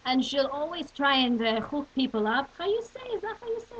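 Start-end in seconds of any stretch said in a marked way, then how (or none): 2.6-3.8 put-on high, questioning Yenta accent. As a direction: ask it like a puzzled Yenta. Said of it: "the Yenta accent".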